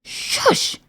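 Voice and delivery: unvoiced, hissing shush; urgent hush; exclaimed shush. A narrator's reading. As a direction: shush sharply. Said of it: "he exclaimed".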